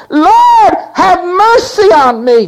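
high, loud crying plea; mother crying out to Jesus for her daughter, loud and high. Acted. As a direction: wail it out for mercy. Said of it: "She cried out".

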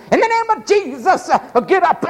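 high-pitched faith-healer command; mock charismatic healer. Preacher imitating charismatics at a wheelchair, mock healing command. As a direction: imitate a revivalist ordering a healing. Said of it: "all those charismatics".

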